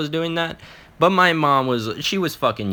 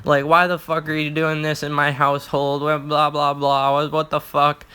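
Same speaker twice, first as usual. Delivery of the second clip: imitating pissed-off mom; 5 semitones higher than usual. Mom confronting her son in her house, mock angry scolding. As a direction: play an angry mom's rant without yelling. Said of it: "my mom is just fucking pissed".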